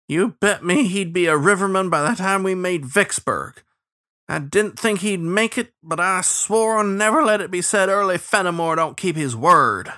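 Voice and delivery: high, aggrieved cook; pouting. A narrator's reading. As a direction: complain petulantly about a bet owed. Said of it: "Early pouted".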